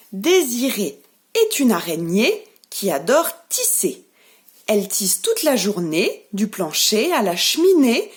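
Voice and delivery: put-on expressive rhyme for small children, pausing after each phrase; nursery rhyme recital. Shown as a demonstration. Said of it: "Je vais vous dire la petite comptine". (comptine récitée de façon expressive)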